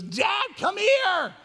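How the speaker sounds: put-on high, drawn-out call of a child from the other room. Four-year-old calling his dad from another room, high and loud. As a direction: call Dad from the back bedroom, high and slow. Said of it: "He goes".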